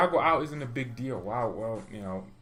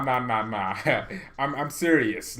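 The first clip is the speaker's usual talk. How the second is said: ordinary talk, nothing marked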